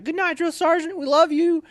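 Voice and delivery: very high, loud sweet-talking call; sing-song goodnight. Retold or imitated. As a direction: tease him with affection. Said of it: "And we'd leave and like".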